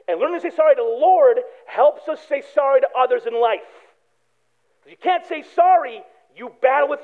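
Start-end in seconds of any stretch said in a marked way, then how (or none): none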